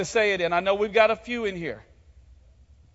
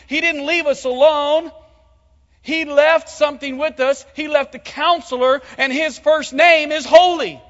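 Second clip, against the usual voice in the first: loud, high-pitched preaching at full force; 6 semitones higher, 7 dB louder than usual. Acted. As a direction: proclaim it to the whole church.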